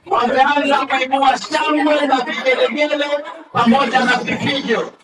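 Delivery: normal talking voice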